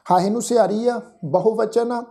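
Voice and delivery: ordinary talk, nothing marked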